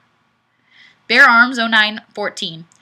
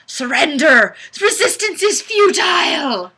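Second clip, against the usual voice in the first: higher, louder hammed-up battle cry; mock villain shout; 6 semitones higher, 5 dB louder than usual. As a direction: bellow it like a cartoon conqueror. Mock villain threat in comment.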